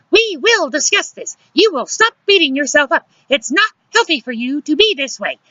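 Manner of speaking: shrieky, very high scolding. A narrator's reading. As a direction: call after him, drawing out the end. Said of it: "Luciana raising her voice".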